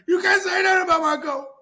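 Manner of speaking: high, loud whining mock shout; mocking upset fan. Mocking fans defending their favourite rapper. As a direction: shriek it like a hurt superfan. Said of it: "They're like".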